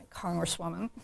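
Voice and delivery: ordinary talk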